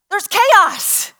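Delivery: high, loud announcement; excited storyteller's exclamation; dramatic alarm. Real, not acted. Announcing the crowd's commotion.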